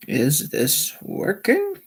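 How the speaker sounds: slow testing question. Real, not acted. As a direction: draw out each word, lifting to a questioning end. Repeats the stream check, drawn out.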